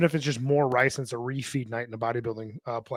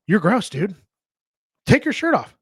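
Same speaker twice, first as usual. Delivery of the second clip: higher, louder, blunt jab; blunt tough-love call; 6 semitones higher, 5 dB louder than usual. Retold or imitated. Coach telling a guy bluntly to take his shirt off. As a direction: call him out hard. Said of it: "You can't tell people they're".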